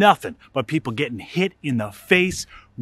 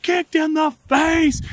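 put-on gleeful kid shout; 12 semitones higher, slower than usual. Boys gloating at the kick, high slow shout. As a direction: savour each word like a jerk kid. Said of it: "We're like".

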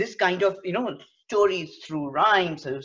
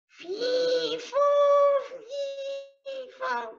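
very high, squeaky little fee fo; a tiny creature's voice, far up high; much slower than usual. Shown as a demonstration. Teacher demoing tiny creature voice, very high. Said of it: "a voice of a tiny creature".

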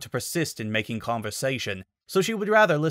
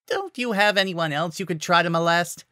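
higher, spiteful jab; malignant; 7 semitones higher than usual. A narrator's reading. Miyu jabbing spitefully at Panther, higher voice. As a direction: sneer the question at him. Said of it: "she inquired malignantly".

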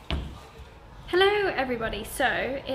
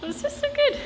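very high, excited squeal, a bit louder; 5 dB louder than usual. Real, not acted. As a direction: squeal it, thrilled by a gifted pupil. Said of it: "I'm so excited".

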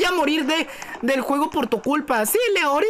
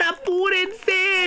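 long drawn-out call to hurry; slow, insistent urging call; urgent hurry-up; 3 semitones higher, much slower than usual. Real, not acted. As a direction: stretch it out to rush the team. Urging teammates as time runs out.

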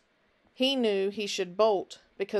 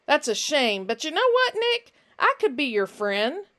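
louder, sing-song high lift on know and Nick; sly stranger Finch; 7 semitones higher, 6 dB louder than usual. Acted. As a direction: purr a creepy offer of friendship. Finch offers false friendship.

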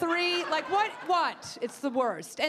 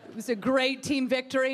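ordinary talk

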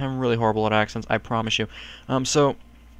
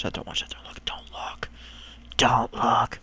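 repeated warning fading into a whisper; urgent whisper; 3 semitones lower, 3 dB quieter than usual. Real, not acted. Gamer shielding viewers from a spoiler, urgent whisper. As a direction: trail down to an unvoiced hush.

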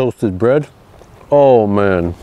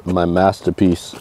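ordinary delivery

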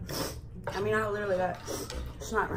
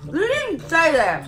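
higher, louder, slow protest; indignant; 9 semitones higher, 8 dB louder, slower than usual. Real, not acted. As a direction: flatly deny it, heated. Heated argument over the rules.

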